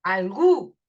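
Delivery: "algú" with a strong, held U; stressed final syllable. Shown as a demonstration. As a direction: lean hard on the U of "algú". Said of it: "se pronuncia con más fuerza". ("algú" con la U fuerte y alargada)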